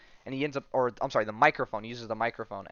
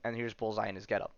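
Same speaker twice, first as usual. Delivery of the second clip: ordinary talk, nothing marked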